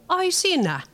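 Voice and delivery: scoffing. Acted. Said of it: "Leena tuhahti".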